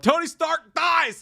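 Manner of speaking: high, slow mock yell of a spoiler. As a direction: drag it out like a troll shouting spoilers. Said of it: "came out and yelled".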